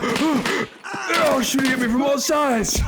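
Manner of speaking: high, drawn-out yell under fire; panicked shout. Real, not acted. Player shot at from every side, panicked high shout. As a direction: cry out high, stretching the words.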